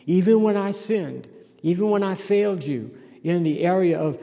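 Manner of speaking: impassioned sermon peak; preaching build. Acted. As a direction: drive the point home. Preaching at force.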